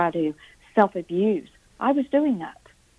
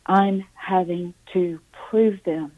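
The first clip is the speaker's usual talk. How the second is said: ordinary talk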